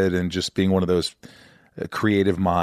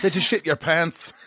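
higher-pitched stab at Scottish; mock Scottish accent; 8 semitones higher than usual. Host testing a saying on Scottish guest, mock Scottish accent. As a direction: try it in an amateur Scottish accent. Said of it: "say that in Scottish".

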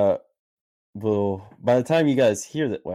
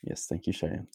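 whispering; 3 semitones lower, 10 dB quieter than usual. Real, not acted. Whispered aside of thanks.

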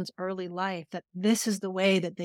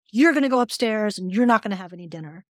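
louder, higher parent's yell; retold yelling; 3 semitones higher, 9 dB louder than usual. Stressed mother sending her daughter upstairs without dinner, yelling. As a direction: yell it like a parent who lost it. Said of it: "I just yelled at her".

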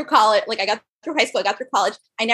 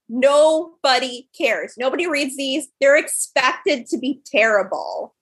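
slow blunt boss; slower than usual. Retold or imitated. Retelling the CEO's blunt dismissal.

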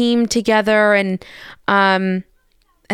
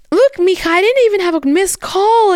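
much higher, louder mom defending herself; imitating her mom; 12 semitones higher, 4 dB louder than usual. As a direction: play her mom protesting her innocence. Mom's defensive voice.

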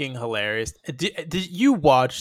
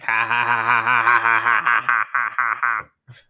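low, mechanical ha ha ha; robotic dubbed laugh; 6 semitones lower than usual. Retold or imitated. Co-host imitating the Shockmaster's robotic dubbed laugh. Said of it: "the fucking anvil laugh, but dubbed over and robotic".

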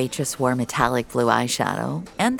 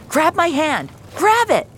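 high, loud urgent command; shouting to a trapped girl; 13 semitones higher, 5 dB louder than usual. Acted. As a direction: yell it to reach her through the wreck. Rescue from burning car.

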